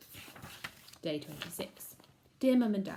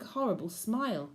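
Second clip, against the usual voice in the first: usual speaking voice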